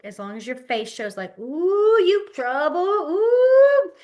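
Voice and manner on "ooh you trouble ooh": high, loud singsong ooh; playful mock scolding; playful teasing. Shown as a demonstration. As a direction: tease like a kid in trouble. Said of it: "you can do it as a joke".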